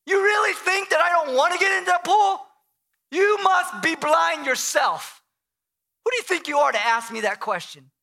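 angry and scornful, acted; hateful, arrogant. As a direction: throw the question back with contempt. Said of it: "really hateful and, and almost arrogant".

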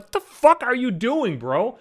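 high, drawn-out startled self-rebuke; slow, incredulous scolding of himself. Retold or imitated. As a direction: re-enact catching yourself in a dumb mistake. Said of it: "I, like, startled myself".

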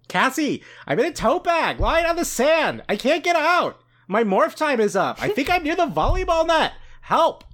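high-pitched, wailing plea; panicky Valley Girl. Acted. Trapped ferret begging Cassie for rescue, Valley Girl wail. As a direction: cry for help like a whiny Valley Girl. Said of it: "Valley Girl with that".

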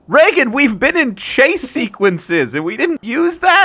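high-pitched, exasperated disbelief; can't believe nobody thought of this, voice shooting up; incredulous outburst. Acted. Learns of unused nitro boost.

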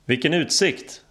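high, exclaimed call. A narrator's reading. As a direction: call it out over rushing wind. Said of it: "ropade Dave någonstans bakom mig".

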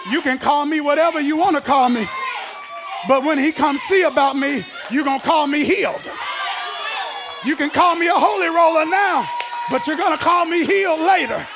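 loud, insistent sermon cadence; full-force preaching. Acted. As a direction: holler it out in rolling parallel phrases.

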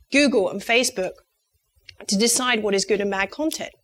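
ordinary talk

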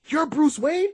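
high, astonished question; high-pitched mock disbelief; Batgirl shocked. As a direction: gasp it as a stunned discovery. Batgirl discovers identity, acted.

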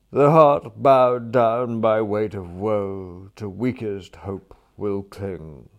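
slow, weighty lament; secret sorrow. Acted. Gloomy friend confiding as they stroll, slow melodramatic sorrow. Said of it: "the air of a man with a secret sorrow".

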